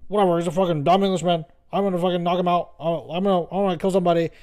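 mock a cocky American fighter ranting, hurried; imitation of a fighter talking tough; Sean. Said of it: "imagine Sean being like".

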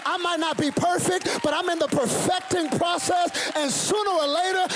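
high, driving, impassioned preacher's declaration; full-force preaching. Real, not acted. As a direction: declare it triumphantly to the congregation.

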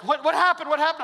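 jolted awake and confused, demand to know; startled panic. Retold or imitated. Kids jolted awake in van.

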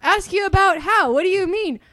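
loud, high-pitched mock confusion; baffled customer. Customer missing the rep's hint about the fee, baffled. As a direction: ham up the clueless questioning.

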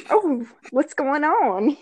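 playfully suggestive, stretched out; flirty. Retold or imitated. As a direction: act out a coy, drawn-out come-on. Acts out flirting with the look.

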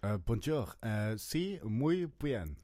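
hammed-up French doctor; mixed-up French doctor. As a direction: mumble bad foreign greetings. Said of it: "I'm in France".

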